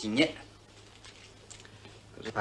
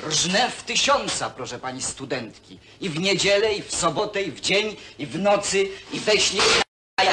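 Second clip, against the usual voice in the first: angry outburst; 9 semitones higher, 6 dB louder than usual. Acted. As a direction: throw it back at her in anger. Man angrily answers her questions. (gniewny, podniesiony krzyk)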